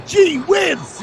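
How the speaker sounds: put-on high squeaky eager kid voice; gee whiz kid. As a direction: gush it like a wide-eyed sidekick. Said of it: "he's one of that where there's aliens".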